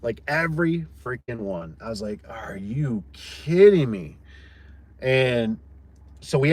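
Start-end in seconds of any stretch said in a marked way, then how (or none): 2.3-4.2 slow, stretched-out exasperation; exasperated, dragging kidding me; drawn-out disbelief. Retold or imitated. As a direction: draw out every word in disbelief. His reaction to botched cuts.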